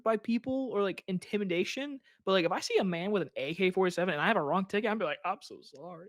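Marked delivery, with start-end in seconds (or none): 5.2-6.1 small, soft mock apology; meek apology. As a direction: apologize timidly, as if facing a gunman. Acting meek before an armed man.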